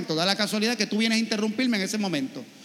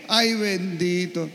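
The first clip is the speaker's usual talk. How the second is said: mimicking the lady's pitying ay; imitating pity; much slower than usual. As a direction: sigh it like a sorry old lady. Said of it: "Le dio pena conmigo".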